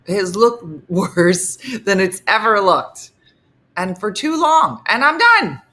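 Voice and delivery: venting loudly, re-enacting the outburst; exasperated yelling. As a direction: build to a fed-up, half-laughing yell. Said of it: "feel like I'm yelling at everybody".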